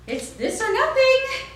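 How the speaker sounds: very high, loud defiant ultimatum; defiant kid. Retold or imitated. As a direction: dig in and refuse. Boy defies his mother.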